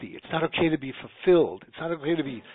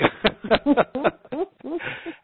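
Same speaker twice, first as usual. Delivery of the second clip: high laugh; 13 semitones higher, 4 dB louder than usual. Real, not acted. Laughing at own joke.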